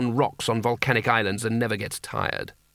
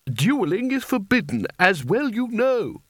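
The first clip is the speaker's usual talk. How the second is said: high, slow, prissy disapproving wizard; 9 semitones higher than usual. Acted. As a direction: sniff at the child's request from up high. Spelter objects to the duel.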